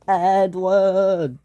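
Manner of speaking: long, drawn-out name call. Acted. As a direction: call to a friend across the water. Distant voice calls Edward.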